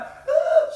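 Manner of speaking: high shrill mock shriek of a scared girl; loud hammed-up squeal of fright; mock scream. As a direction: shriek like a frightened kid stepping on grass. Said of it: "She was like".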